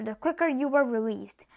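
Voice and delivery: ordinary delivery, nothing marked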